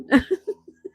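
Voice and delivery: loud high giggle; high laugh. Real, not acted. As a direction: burst into laughter. Laughing at chat joke.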